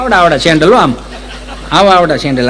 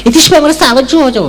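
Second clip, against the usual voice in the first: a higher, mincing educated lady; prim city lady; 6 semitones higher than usual. Retold or imitated. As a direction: mimic a fussy city woman picking each word. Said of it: "Gujarati ચીપી ચીપીને બોલે". (શહેરી બેનની ચીપી ચીપીને બોલતી નકલ)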